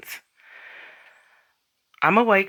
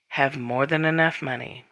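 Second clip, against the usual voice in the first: ordinary talk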